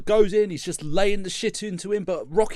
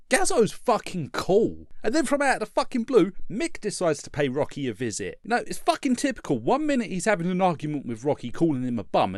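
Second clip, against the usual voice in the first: ordinary delivery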